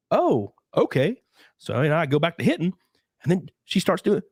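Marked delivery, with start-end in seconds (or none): 0.1-1.2 drawn-out doubtful okay; slow, unsure. Retold or imitated. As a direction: stretch it slowly, not convinced. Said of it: "And I'm like".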